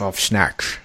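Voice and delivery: slurred "snatched" in a Scottish film-star accent; lightly slushy Connery-like accent on "snatched"; Sean Connery. Real, not acted. Co-host retrying the phrase, Sean Connery accent. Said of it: "is that your, uh, Sean Connery accent".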